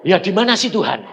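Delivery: high, loud, puzzled question; bewildered retort. Retold or imitated. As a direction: act out baffled protest to God. Retold bewildered answer to God.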